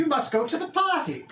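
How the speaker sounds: high-pitched, deferential goblin voice; goblin servant. Acted. Goblin greets a lost lord, high servile voice. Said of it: "a goblin comes up to you and he's like".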